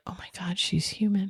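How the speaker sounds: hushed whisper. Retold or imitated. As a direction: whisper it like a shocked coworker. Coworkers reacting, acted quote.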